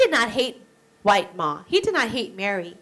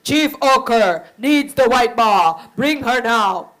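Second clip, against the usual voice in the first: demanding messenger; 5 dB louder than usual. Acted. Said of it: "The messenger said".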